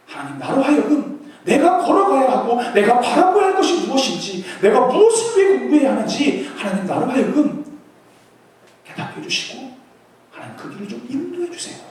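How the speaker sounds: loud, pleading prayer; fervent prayer. Shown as a demonstration. Preacher praying aloud for students' path, fervent. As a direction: pour it out to God. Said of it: "하나님 앞에 기도하세요".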